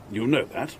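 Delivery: usual speaking voice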